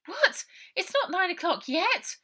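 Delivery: shocked disbelief. Acted. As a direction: she can't believe they're late. Ida shocked at being late.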